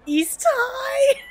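high, loud cheer; big high rallying shout; hammed-up team cheer. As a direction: holler it like a pep rally chant. Mock cheer from the game.

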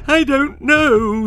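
high, loud, slow panicked scream; high drawn-out frightened cry. Acted. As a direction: scream in terror. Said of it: "screamed Wen".

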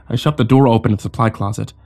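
usual speaking voice

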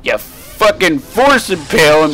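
high, frustrated shouting; mad. Real, not acted. Player to enemies not letting him do his move, mad shouting. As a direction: yell in real exasperation at being blocked. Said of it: "getting mad".

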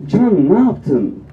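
loud, stretched-out reaction; mock ad mother. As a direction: draw it out like a mum in an advert. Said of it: "Bak annesindeki tepki şu". (reklamdaki anne taklidi)

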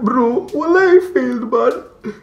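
hammed-up tearful complaint; mock crying. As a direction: whimper it like a crybaby. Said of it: "comes crying to you".

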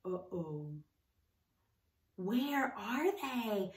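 low soft uh-oh, slowly; quietly worried, something's wrong; hushed worry. Acted. Teacher hushed as the animals disappear.

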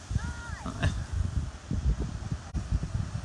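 long, high, airy laugh; real giggling fit; amused laugh. Real, not acted. Laughing about the Kentucky accent.

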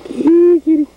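very high, loud kitty call; calling the cat. Real, not acted. Owner calls to his cat, high squealed call.

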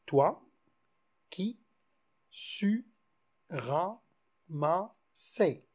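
toi, qui, su, ra, ma, se counted one syllable at a time. Shown as a demonstration. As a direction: tap out each syllable separately. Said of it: "يتكون من ستة مقاطع".

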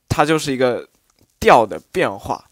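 ordinary talk, nothing marked

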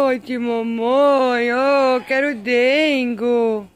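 high, slow, pleading kitty voice; the cat whining sweetly to its mom for petting; cat voice begging for cuddles. Pretend. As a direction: speak as the cat wanting affection.

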